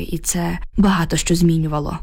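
ordinary delivery

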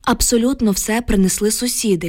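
usual speaking voice

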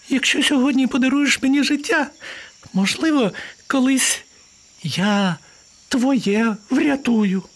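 very high, halting little mouse; pleading mouse. Acted. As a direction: bargain timidly in a tiny high voice. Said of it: "стала благати мишка". (благальний мишачий голосок)

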